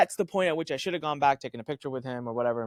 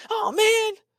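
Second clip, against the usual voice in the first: much higher, awed gasp of a star-struck guard; stunned fan; 8 dB louder than usual. Retold or imitated. Security guard recognizing a famous client, awed high "oh man". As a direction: let it fall out in disbelief. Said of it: "He goes".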